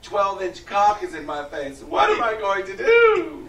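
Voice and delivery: high, slow, hammed-up damsel in distress; mocking the accuser. Podcast host mocks the accuser in a high fake-shocked voice. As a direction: play the accuser as a fake-shocked prim lady. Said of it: "acts the woman, mocking".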